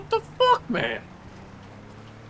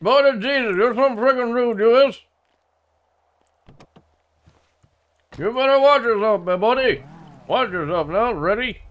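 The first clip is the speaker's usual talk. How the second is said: put-on thick rural accent. Local insists this is how he always talks, thick rural accent. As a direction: lean hard on the rural accent and the boy.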